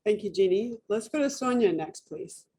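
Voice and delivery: ordinary talk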